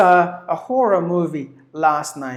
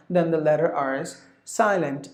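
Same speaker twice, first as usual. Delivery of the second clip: ordinary talk, nothing marked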